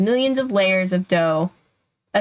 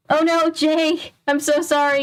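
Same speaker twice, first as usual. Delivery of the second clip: dismayed apology; 8 semitones higher than usual. Real, not acted. Host to a viewer wrongly timed out, dismayed apology. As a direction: apologise in a high, stricken rush of sympathy.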